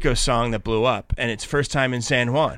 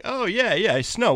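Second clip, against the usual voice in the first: breezy higher "oh yeah, snow"; dismissive mock shrug; 7 semitones higher, slower than usual. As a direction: brush it off like it's nothing. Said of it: "And I'm like".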